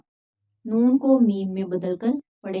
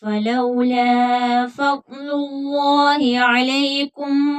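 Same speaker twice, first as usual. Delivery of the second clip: slow melodic tilawat, higher and louder, long vowels; Quran recitation; 4 semitones higher, 5 dB louder, much slower than usual. Acted. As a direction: chant the verse reverently, stretching each word. Recites verses after explanation.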